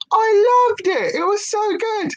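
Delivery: dreamy high-pitched gush; gushing high swoon. Shown as a demonstration. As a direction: swoon over the memory, way up high.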